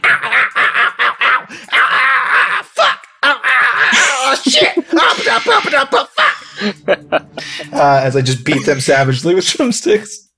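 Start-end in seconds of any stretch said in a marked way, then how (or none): 0.0-6.1 high pained squeals, yelling; squealing goblins. Acted. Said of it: "they'll be like". 6.2-7.7 laugh. Real, not acted. Laughs after goblin squeals.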